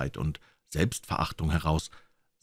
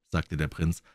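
ordinary delivery

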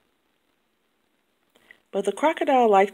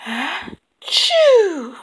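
a huge elephant sneeze; big sneeze; 11 semitones higher, 7 dB louder than usual. A narrator's reading. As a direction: gasp then a high, loud achoo.

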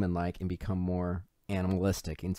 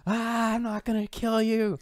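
mock scream; 11 semitones higher than usual. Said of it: "he just screams".

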